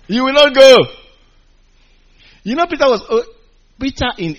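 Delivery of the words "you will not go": forceful loud scolding of Jesus; full-voiced mock rebuke. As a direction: boom it out like a stern rebuke.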